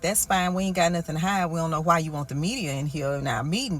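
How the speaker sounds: ordinary talk, nothing marked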